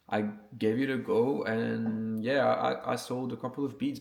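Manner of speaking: ordinary talk, nothing marked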